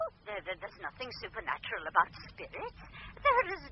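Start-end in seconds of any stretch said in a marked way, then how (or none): 0.0-0.6 breathy soothing. Acted. Medium soothing the caller.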